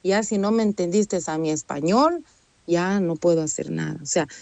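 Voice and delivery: normal talking voice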